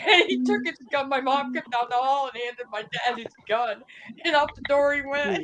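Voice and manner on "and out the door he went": ordinary talk, nothing marked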